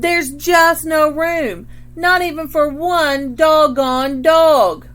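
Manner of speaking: loud, high, slow exasperation; fed-up mom at the end of her rope; exasperated mom. A narrator's reading. Mom overwhelmed by the new dog, loud slow exasperation. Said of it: "Mom shook her head".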